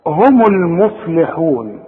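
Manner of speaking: "هم المفلحون" slow, meem voweled with u; "هم المفلحون" read carefully, "humul-muflihun"; meem with damma. Shown as a demonstration. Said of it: "الميم عليها ضمة".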